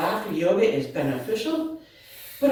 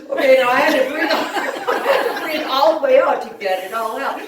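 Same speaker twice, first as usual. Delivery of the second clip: words pushed out through real laughter, higher; giggling through the words; laughing while talking; 4 semitones higher, 3 dB louder than usual. Real, not acted. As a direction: talk as you catch your breath from laughing. Still laughing after the breath.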